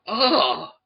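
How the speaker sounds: high, loud "ah" as the penny drops; mock-alarmed dawning realisation; retold realisation. Retold man's sudden realisation repeated.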